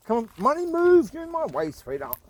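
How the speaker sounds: rising to a loud, high "move"; urging the dog on. Real, not acted. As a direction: hurry the dog out of the way. Dog blocking the path.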